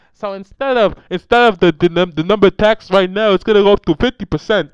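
very loud put-on politician voice; Bernie Sanders accent. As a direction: bellow it in an imitated accent. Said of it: "Let me try to do my best accent".

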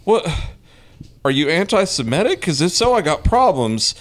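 high, animated mock outrage; mock indignant rant. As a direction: play up the indignation. Retold rant at vandals.

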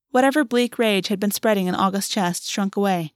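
ordinary delivery, nothing marked

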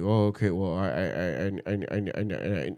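imitating a cousin's stuck stammer. Cousin stalling on the phone to the speaker, low mock stammer. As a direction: stutter on "I" over and over, low and soft. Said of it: "Slow down".

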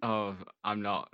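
ordinary talk

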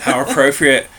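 usual speaking voice

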